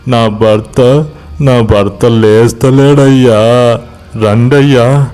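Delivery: slow, plaintive drawn-out begging; tearful pleading wife. Acted. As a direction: plead in an acted weepy sing-song.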